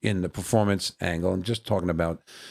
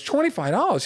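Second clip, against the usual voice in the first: much higher, incredulous repeat of the price; incredulous echo; 3 dB louder than usual. Retold or imitated. Comedian questioning the club owner's price, incredulous. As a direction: throw TWENTY-FIVE DOLLARS back in high disbelief. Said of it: "Anthony goes".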